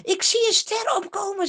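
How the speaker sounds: high, loud exclamation; a seer suddenly proclaiming; calling out. A narrator's reading. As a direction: cry out the vision. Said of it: "Ineens roept hij het uit".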